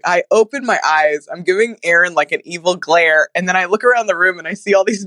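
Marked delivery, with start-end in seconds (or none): none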